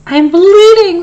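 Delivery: high, loud yelp. A narrator's reading. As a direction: cry out in alarm. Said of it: "the fox yelped".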